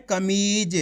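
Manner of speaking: कमीज with a long, stretched ee; drawn-out reading. Shown as a demonstration. Teacher shows learners how to stretch the word, drawn-out कमीज. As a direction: draw out the middle of कमीज. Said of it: "इसी तरीके से आपको खींच के पढ़ना है".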